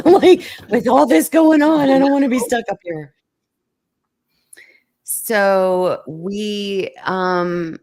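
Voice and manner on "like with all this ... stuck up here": laughing it off, high and loud; nervous laugh through the words. Real, not acted. Retelling the scary drive to a friend, laughing.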